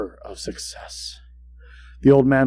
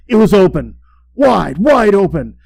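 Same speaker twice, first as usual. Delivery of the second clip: higher, louder, slowing on wide open; rising horror; 6 semitones higher, 7 dB louder than usual. A narrator's reading. Narrator staring at the old man's open eye, rising horror.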